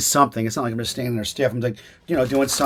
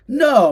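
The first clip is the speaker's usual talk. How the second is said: No said high and a bit louder; 13 semitones higher, 8 dB louder than usual. Retold or imitated. Actor contrasting readings of a line: high No. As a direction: pitch No up high. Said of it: "instead of".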